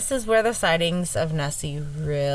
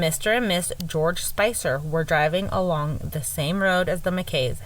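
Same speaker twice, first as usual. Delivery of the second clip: ordinary talk, nothing marked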